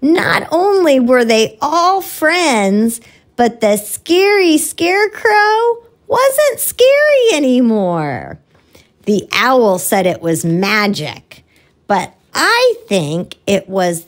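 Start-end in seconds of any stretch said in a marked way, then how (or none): none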